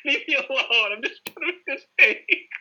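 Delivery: squeaky, hammed-up protest at teasing parents; whiny mock self. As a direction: whine like a pestered kid. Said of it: "I'm like".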